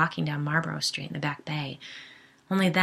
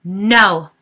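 loud sharp cry of no; yelled; 3 semitones higher, 13 dB louder than usual. A narrator's reading. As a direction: yell it to stop them. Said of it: "the couple yelled".

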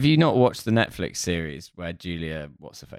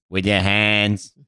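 raised, drawn-out mock certainty; perfect confidence; 5 semitones higher, slower than usual. George to the board about his Snickers, perfectly confident. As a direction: declare it slowly, building higher with total assurance. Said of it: "perfect confidence".

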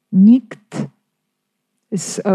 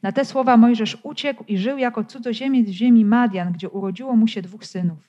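scripture reading; 3 semitones higher, faster than usual. Acted. Preacher reads Acts aloud to the congregation, measured scripture reading. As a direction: read the Bible passage aloud to the congregation.

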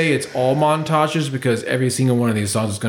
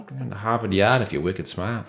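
put-on R-less Boston accent, understated; 3 semitones lower, 4 dB quieter than usual. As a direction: toss it off like a Boston local. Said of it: "my Boston or Massachusetts accents".

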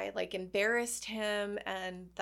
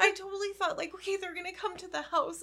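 laughing near tears; 9 semitones higher, faster than usual. Real, not acted. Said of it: "I'm gonna cry again".

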